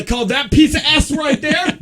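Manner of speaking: joking shout. Acted. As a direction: shout it out as a joke. Jokey outburst at cohost.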